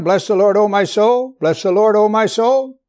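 loud, insistent "Bless the Lord, O my soul", rising on "soul"; emphatic repetition. Shown as a demonstration. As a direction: repeat it with force, lifting each "soul". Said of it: "So it's emphasized".

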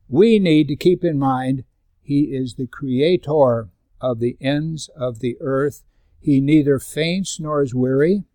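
loud, slow proclamation; slow preaching. Acted. Pastor proclaiming God to the congregation, loud and slow. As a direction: proclaim each phrase slowly.

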